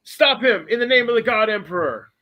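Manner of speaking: high, loud desperate command; loud, high-pitched dramatic shout; acted yell. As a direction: yell it out as a frantic order to stop. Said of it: "He yells".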